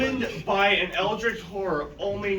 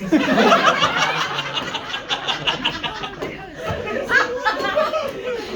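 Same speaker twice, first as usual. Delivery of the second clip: long laugh; 13 semitones higher, 4 dB louder than usual. Real, not acted. Laughing after face threat joke.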